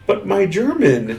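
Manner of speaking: mock naive American. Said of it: "this American in me was just like".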